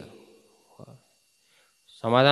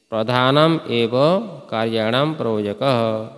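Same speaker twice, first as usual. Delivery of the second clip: louder, each word spelled out for dictation; emphatic dictation; 4 dB louder than usual. Shown as a demonstration. Teacher loudly dictating the rule for students to write. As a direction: say प्रधानम एव firmly for students to write. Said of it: "लिखो".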